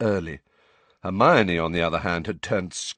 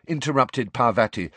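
ordinary delivery

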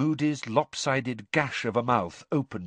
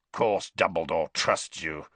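growled; 6 semitones lower than usual. A narrator's reading. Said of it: "growled Moody".

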